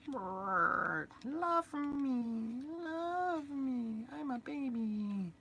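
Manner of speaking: very high squeaky menacing little man; a tiny creep luring you in, high-pitched; high squeaky voice of a sinister little doll; creepy toy voice. Acted. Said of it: "I made this guy".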